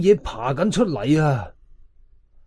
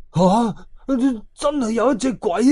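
higher, slower scared disbelief; frightened; 7 semitones higher, slower than usual. A narrator's reading. 格仔叔 learning a ghost was near, mildly afraid. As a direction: quiver with mild fear at the news. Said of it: "格仔叔腳仔都打震啊".

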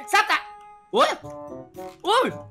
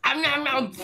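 munching noises; 3 semitones lower, 4 dB quieter, slower than usual. Acted. Eating noises.

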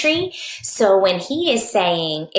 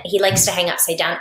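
ordinary delivery

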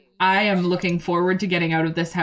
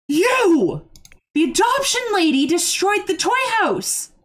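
much higher, louder outraged cry; high, loud shocked exclamation; shocked outcry; 10 semitones higher, 4 dB louder than usual. Real, not acted. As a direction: cry out in disbelief at the mess. Shocked outburst at game.

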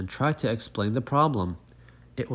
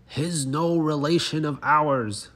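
indignant frogs; 5 semitones higher than usual. Acted. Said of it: "declared the frogs".

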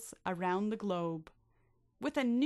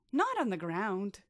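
usual speaking voice

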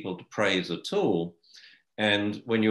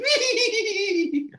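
very high, squealed "Wee!" through laughter; squeaky "wee"; 4 dB louder than usual. Retold or imitated. Mocking a squeaky owner to the puppy, laughing squeal. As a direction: squeal it like a silly dog owner. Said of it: "he goes".

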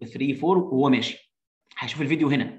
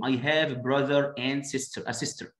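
slow model reading; much slower than usual. Shown as a demonstration. Reading child's line slowly.

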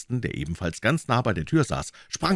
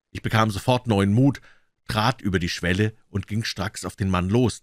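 usual speaking voice

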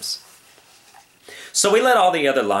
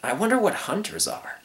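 put-on higher, musing young lion; wondering to himself; 9 semitones higher, 4 dB quieter than usual. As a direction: think aloud with curiosity. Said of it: "he said to himself".

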